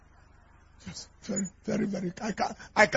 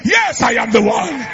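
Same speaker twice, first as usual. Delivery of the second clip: hammed-up loud "Yes, I am the one"; mock scream; 4 semitones higher, 6 dB louder than usual. Shown as a demonstration. Pastor showing the screaming answer she expected, mock shout. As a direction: shout it like quarreling on the road. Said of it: "be screaming and be quarreling on the road".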